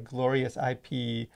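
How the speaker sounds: ordinary talk, nothing marked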